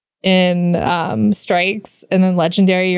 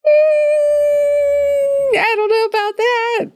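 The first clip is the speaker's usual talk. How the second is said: skeptical; much slower than usual. Real, not acted. Skeptical reply to passionate.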